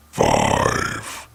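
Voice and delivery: very low, drawn-out monstrous announcer; spooky countdown voice. Acted. Narrator announces the next creepy story, deep spooky countdown. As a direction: drop deep and stretch the number out.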